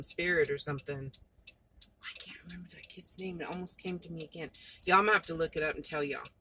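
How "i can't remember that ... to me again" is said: unvoiced muttered aside to herself; whisper to herself. Real, not acted. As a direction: say it under your breath, musing.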